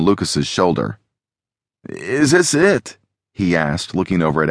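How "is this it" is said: slow, high, rising question; wondering question. A narrator's reading. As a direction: ask slowly, pitched up in wonder. Tagged Lucas asks whether he's out.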